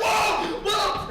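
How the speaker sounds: high, loud panicked whoa; imitating a friend's freak-out shout; startled yell. Acted cry of Keith flipping out.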